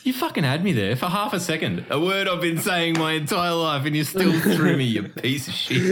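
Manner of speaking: high-pitched amused indignation; mock outrage. Real, not acted. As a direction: protest at being had, voice pitched right up. Pranked, mock outrage amid laughter.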